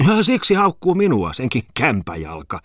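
indignant, starting high and loud; flaring up. A narrator's reading. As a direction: flare up at the insult. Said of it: "kiivastui Tommola".

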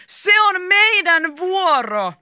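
high, loud, drawn-out alarm; startled cry. A narrator's reading. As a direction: cry out in sudden fright. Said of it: "pelästyneenä ja sanoivat yhteen ääneen". (pelästynyt huudahdus)

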